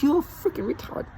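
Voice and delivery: scornful laughing taunt. Retold or imitated. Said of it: "I'm like".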